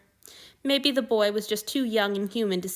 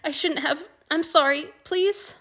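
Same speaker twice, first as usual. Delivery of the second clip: higher and louder, apologising through tears; tearful pleading; 8 semitones higher, 5 dB louder than usual. A narrator's reading. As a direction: beg forgiveness, voice about to break. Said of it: "keep more traitorous tears from falling".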